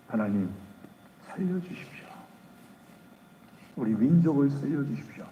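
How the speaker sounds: hushed pleading. Acted. Moses begging God for mercy.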